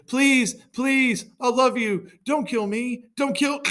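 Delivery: loud, very high panicked pleading; hammed-up plea for life; shouted begging. Greek begs Hector for his life, high panicked shout. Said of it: "a certain Greek shouted".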